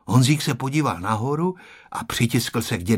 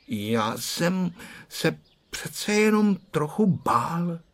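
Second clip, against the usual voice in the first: slow, high-pitched hesitant admission; sheepish confession; 6 semitones higher, 3 dB quieter, slower than usual. A narrator's reading. Blushing boy admitting to grandpa he was scared, sheepish. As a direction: confess it slowly in a small boy's raised voice. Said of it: "začervenal se Honzík".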